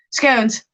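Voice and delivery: "Scones" with a long o, rhyming with bones; proper scones. Shown as a demonstration. Streamer correcting the word she read out, "Scones" rhyming with bones. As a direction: say "Scones" firmly, to rhyme with bones.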